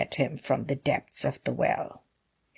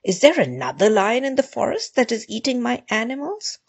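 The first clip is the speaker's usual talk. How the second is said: high-pitched, mildly outraged lion; a touch of storybook outrage; roaring indignation; 7 semitones higher, 3 dB louder, faster than usual. A narrator's reading. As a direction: demand an answer with rising indignation. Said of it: "roared the lion".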